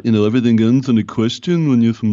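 playful Liverpool accent; mock Scouse with questioning lilt; Merseyside accent. Shown as a demonstration. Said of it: "maybe doing a Merseyside".

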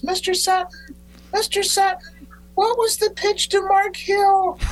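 put-on a young fan calling up to his pitching hero; high-pitched little kid. A boy pesters the pitcher by the bullpen, in a very high kid voice. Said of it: "in my high-pitched voice".

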